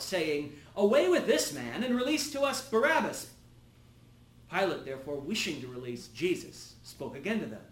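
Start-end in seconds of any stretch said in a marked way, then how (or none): 0.7-3.3 higher, louder call in a narrator's voice; crowd's outcry. A narrator's reading. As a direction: cry out as a demanding crowd, with some restraint. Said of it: "the whole crowd cried out".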